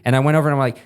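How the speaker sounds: ordinary talk, nothing marked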